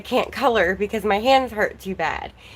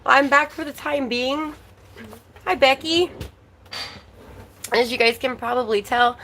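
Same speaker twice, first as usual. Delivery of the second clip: losing her voice, a bit hoarse; slightly worn, hoarse voice; 4 semitones higher than usual. Real, not acted. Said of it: "I'm losing my voice a little bit".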